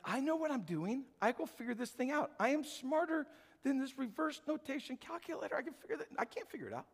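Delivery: high, hushed voice of proud insistence; stubborn pride. Acted. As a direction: insist softly up high, then drop in defeat. Acts out proud person failing.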